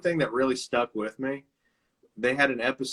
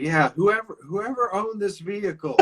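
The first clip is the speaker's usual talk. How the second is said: high, hammed-up plea from the guilty driver in costume; mock hot dog guy; 10 semitones higher, 6 dB louder than usual. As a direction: play innocent, voice pitched up as if pleading. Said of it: "He's like".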